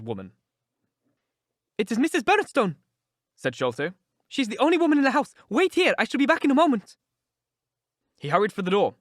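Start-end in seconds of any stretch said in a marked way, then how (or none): 1.8-2.8 high, loud, anxious little man; Sholto, agitated. A narrator's reading. 4.3-7.0 high, loud, anxious little man; Sholto, agitated. A narrator's reading.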